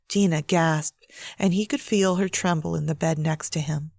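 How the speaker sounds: plain reading voice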